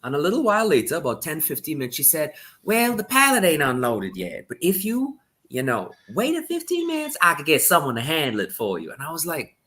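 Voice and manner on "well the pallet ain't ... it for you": high, lilting Southern store clerk voice; Southern lady accent. Retold or imitated. Clerk tells him about the pallet in a high Southern accent. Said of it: "I don't wanna do her accent".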